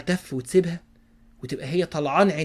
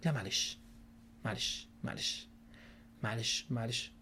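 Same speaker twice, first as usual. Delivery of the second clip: soft, low, hushed there-there; soothing murmur; 7 semitones lower, 16 dB quieter than usual. Shown as a demonstration. Husband soothing his upset wife in a hushed murmur. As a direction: murmur comfort under your breath. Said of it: "حد يمعلشها".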